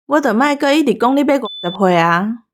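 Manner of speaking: ordinary talk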